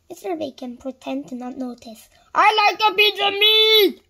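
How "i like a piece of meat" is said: loud, high-pitched shout. A narrator's reading. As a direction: shout to be noticed. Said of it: "Mister Noisy shouted".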